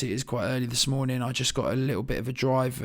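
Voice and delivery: ordinary delivery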